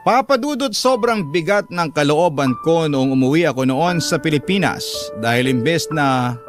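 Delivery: normal talking voice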